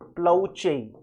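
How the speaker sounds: "प्लाउचे" said as plow-chay after a pause, falling; model pronunciation. Shown as a demonstration. Teacher gives students the pronunciation of the term. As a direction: set apart and said carefully as plow-chay. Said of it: "pronunciation होता है".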